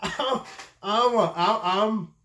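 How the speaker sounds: stammering, lost for words; can't get the words out; stuttering. Real, not acted. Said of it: "I'm sorry if I'm, if I'm stuttering".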